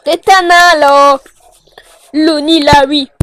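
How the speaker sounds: loud exasperated yell; annoyed shout. Real, not acted. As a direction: bark it in irritation. Annoyed at the monster.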